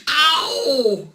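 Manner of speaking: high, loud drawn-out ow; mock pain yelp. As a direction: howl as if it stings. Second balloon mishap.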